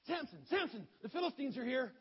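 high, loud warning cry; urgent calling out, high and loud; shouted alarm. Acted. Delilah raises false alarm.

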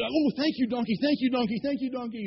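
high, gushing gratitude; hammed-up relieved thankfulness; happy gushing thanks. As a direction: pour out thanks over and over, high. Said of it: "he was pretty happy".

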